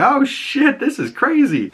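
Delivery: high-pitched, excited disbelief; re-enacted amazed outburst. Viewer reacting to the horse falling, high amazed outburst. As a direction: squeal it like a thrilled viewer. Retells own shocked reaction.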